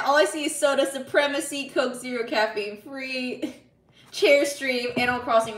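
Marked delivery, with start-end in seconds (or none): none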